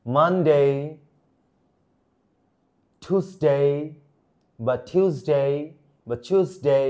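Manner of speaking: each day firm and separate, "ডে" a long "day"; no R in "স্যাটারডে", "ওয়েডনেসডে" as "wens-day"; correct English pronunciation. Shown as a demonstration. Teacher checking from the start, days carefully pronounced with full "ডে" as "day". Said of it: "প্রথম থেকে একটু check করি".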